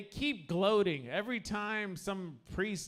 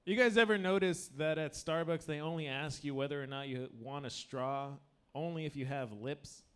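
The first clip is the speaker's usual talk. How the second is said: ordinary delivery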